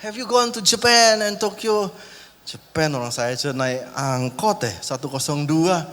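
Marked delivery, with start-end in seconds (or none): 0.0-1.9 lightly higher, breezy English small talk; a rich young guest showing off in English; posh English guest. Retold or imitated. Preacher mimics rich young guest, lightly higher and breezy. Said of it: "Terus ngomongnya".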